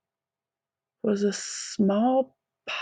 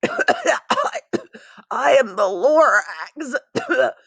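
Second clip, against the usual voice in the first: lightly voiced Lorax, raspy coughs between words; a hint of a wheezy little creature clearing its throat; coughing Lorax; 8 semitones higher, much slower than usual. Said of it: "He coughed and he whiffed".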